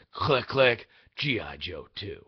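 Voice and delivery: deep trailer announcer voice; low, heavy title-card reveal; movie trailer voice. Acted. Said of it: "That's how I'm doing my trailer impression".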